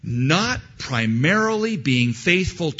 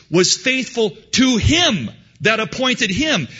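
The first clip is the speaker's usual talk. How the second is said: rising, louder preaching push; 8 semitones higher, 4 dB louder than usual. Acted. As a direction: drive the point home to the congregation. Preaches at full force.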